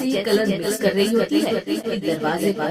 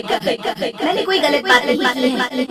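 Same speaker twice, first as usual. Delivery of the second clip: raised, defensive insistence; defensive retort; 3 semitones higher, 4 dB louder than usual. Acted. Wife defending herself to her husband, stubborn and loud. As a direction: insist you did nothing wrong.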